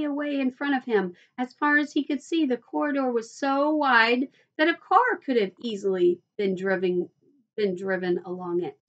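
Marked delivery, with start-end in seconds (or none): none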